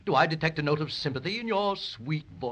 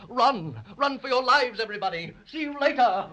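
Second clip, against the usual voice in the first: high, loud cry to flee; yelling at everyone to run for their lives; panicked shout; 7 semitones higher, 5 dB louder than usual. Acted. Dragon yelling at the children to flee, panicked shout.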